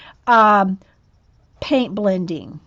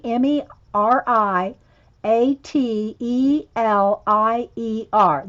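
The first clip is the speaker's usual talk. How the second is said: letter by letter, slow and careful; spelling slowly; slower than usual. Shown as a demonstration. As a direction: spell each letter out deliberately. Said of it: "and it's Mary".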